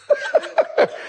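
a light airy chuckle; high breathy laugh. Real, not acted. Speaker to the audience, breathy laugh.

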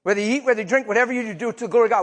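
high, loud, fast preacher's cry; preaching at full force. Acted. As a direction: thunder it out to the congregation.

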